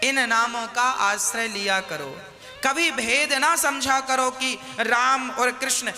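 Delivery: ordinary talk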